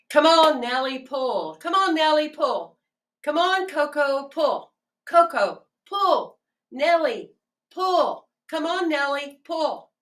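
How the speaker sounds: high, loud calls urging the horses on; farmer calling horses. Acted. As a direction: holler at the team to pull. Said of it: "And the farmer says".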